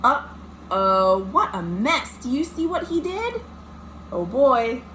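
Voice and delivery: ordinary delivery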